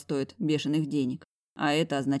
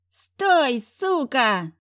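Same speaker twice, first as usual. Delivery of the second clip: high, loud furious yell; furious shout; 8 semitones higher, 6 dB louder, much slower than usual. Acted. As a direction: scream it in rage. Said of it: "злой до одури голос Гришани".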